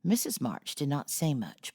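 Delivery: plain reading voice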